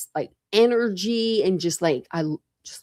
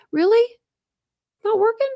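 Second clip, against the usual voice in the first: sharp high disbelief; surprised; 4 dB louder than usual. Real, not acted. Streamer surprised the link fails, high and startled. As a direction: pitch jumps up in surprise.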